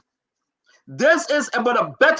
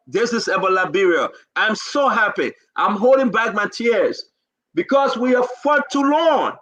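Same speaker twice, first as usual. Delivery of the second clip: ordinary talk, nothing marked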